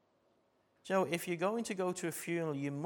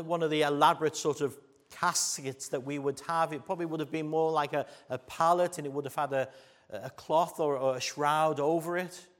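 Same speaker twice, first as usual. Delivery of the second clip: preaching at full force; 8 dB louder than usual. Acted. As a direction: preach it out to the congregation. Preaching the sermon.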